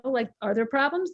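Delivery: usual speaking voice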